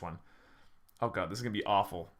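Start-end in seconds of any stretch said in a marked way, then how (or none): none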